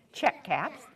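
usual speaking voice

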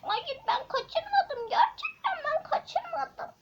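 villain shrilly denying the kidnapping; whiny denial. Acted. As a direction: protest your innocence, whining. Accused villain protests.

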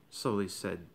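ordinary delivery

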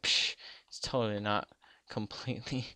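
ordinary talk, nothing marked

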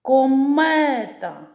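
"Комета" slow, high and loud, second syllable held long; протяжний наголос на другому складі. Shown as a demonstration. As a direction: call out "Комета", stretching the stressed middle syllable. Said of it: "На який склад падає наголос?". («Комета» повільно й голосно, з протягнутим другим складом)

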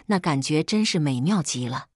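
usual speaking voice

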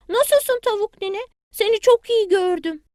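high, eager little chick; a small child calling at granny's door. Acted. Bili Bili greets granny hen at her door, high child voice.

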